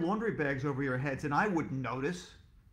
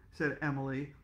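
ordinary delivery

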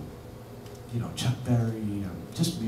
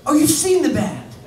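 surprised; 9 dB louder than usual. Retold or imitated. Quoting [name]'s surprise.

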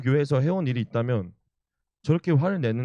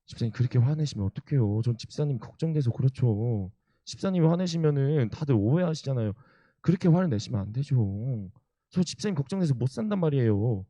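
playful aegyo; 3 semitones higher than usual. Retold or imitated. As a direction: re-enact sweet-talking an elder out of anger. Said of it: "제가 그렇게 막 애교를 부렸습니다". (애교 부리며 달래는 말투)

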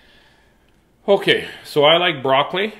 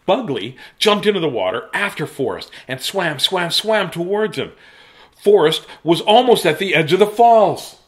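put-on animated, urgent storytelling for children; lively read-aloud of a rescue; dramatic story narration; 5 semitones higher, 5 dB louder than usual. A narrator's reading. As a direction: build the suspense, getting higher and louder.

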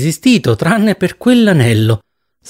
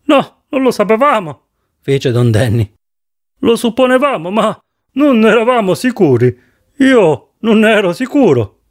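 lightly raised voice of a doubtful colleague; a hint of a higher, uncertain man's voice; Don Danny, unsure; 6 semitones higher than usual. A narrator's reading. Colleague admits to Joe they weren't sure, lightly higher voice.

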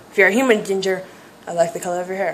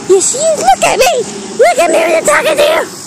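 very high, hyper mock scolding; 3 dB louder than usual. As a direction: demand attention in a squealed playful telling-off. Hyper mock scolding, demanding attention.

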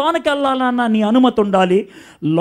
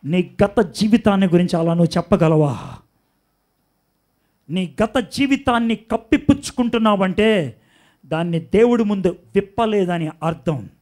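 usual speaking voice

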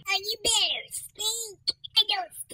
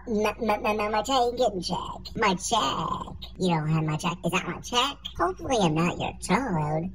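put-on low old man fretting; 11 semitones lower than usual. Old man wants his pension check.

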